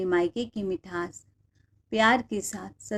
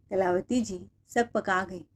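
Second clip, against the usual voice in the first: ordinary delivery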